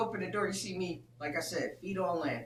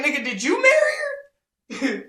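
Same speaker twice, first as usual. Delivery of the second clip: loud, high, slow mock wail; incredulous comeback; 9 semitones higher, 13 dB louder, slower than usual. Man turning the marriage question on rival, incredulous. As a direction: drag out the question in exaggerated disbelief.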